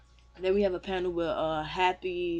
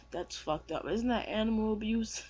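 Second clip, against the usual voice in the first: ordinary delivery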